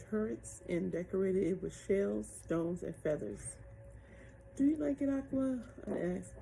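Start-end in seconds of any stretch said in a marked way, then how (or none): 4.6-5.6 high, louder eager ask; eager question. A narrator's reading. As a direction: ask hopefully and brightly. Girl asks eagerly.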